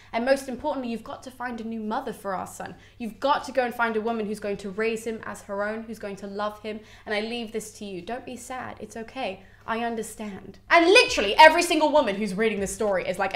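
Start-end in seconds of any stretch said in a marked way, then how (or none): 0.1-10.6 soft, tender farewell; very calm. Acted. Creusa's shade leaves their son to him, very calmly. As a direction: soothe him quietly as you let go. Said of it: "very calmly just looks at him".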